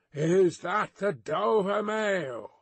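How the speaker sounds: slow, high hail; drawn-out distant call; calling from afar. A narrator's reading. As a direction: repeat the question across the mist. Rider calls again from mist.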